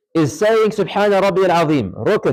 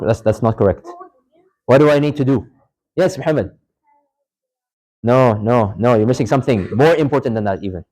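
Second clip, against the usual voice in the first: ordinary delivery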